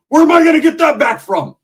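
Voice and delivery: high, loud indignant cry; outraged complaint. Retold or imitated. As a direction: wail about the wasted money. Roach complaining about lost money.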